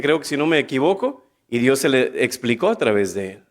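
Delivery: usual speaking voice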